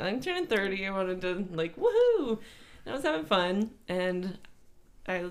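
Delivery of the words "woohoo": high, excited woohoo; party whoop. Retold or imitated. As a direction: cheer like at a party. Mock party cheer.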